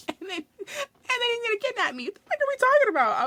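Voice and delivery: high, squealing laugh-cry; laughing shriek. Real, not acted. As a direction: lose it laughing in disbelief. Laughing disbelief at imagined kidnap.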